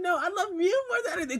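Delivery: high, excited mock argument of lovers; giddy one-upping. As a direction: top her, high and giddy. Topping her in lovers' exchange.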